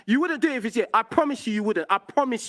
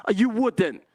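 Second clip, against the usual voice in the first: ordinary delivery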